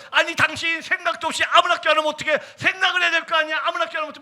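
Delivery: high, agitated telling-off; retold angry scolding. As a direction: scold a spouse in exasperation. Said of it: "제가 막 화를 냈어요".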